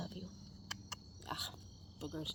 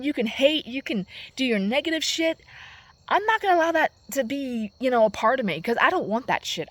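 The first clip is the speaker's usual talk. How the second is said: louder defiant venting; louder defiant rant about her; 6 dB louder than usual. Real, not acted. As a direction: push back at her, louder.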